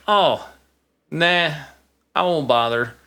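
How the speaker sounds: high-pitched, drawn-out brush-off; mock reluctant, can't be bothered; dismissive reluctance. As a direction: wave it away with a long "oh, nah". Retelling own dismissive reaction.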